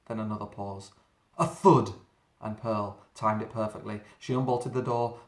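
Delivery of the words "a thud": loud, heavy thud; booming thud. Acted. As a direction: slam the word like a shoulder on the door. Monster pounding the door.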